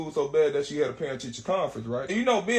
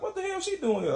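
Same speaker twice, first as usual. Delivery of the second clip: high, incredulous niece voice; mock-shocked kid asking with her eyes; niece's silent look; 13 semitones higher than usual. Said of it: "She gave me that look like".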